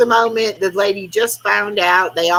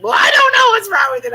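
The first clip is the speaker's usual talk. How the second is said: flustered friend; 11 semitones higher, 4 dB louder, faster than usual. Retold or imitated. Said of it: "poor Roxanne is like".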